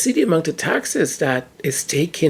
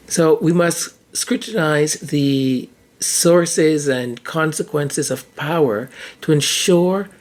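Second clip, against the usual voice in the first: ordinary delivery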